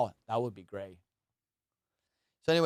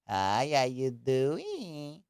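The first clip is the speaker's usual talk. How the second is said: slow put-on pet-talk voice, Hi, how you doing drawn out; slow, goofy dog-talk voice; stretched, silly voice for talking to the dog; Doogie voice; much slower than usual. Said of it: "the language that I talk to Doogie".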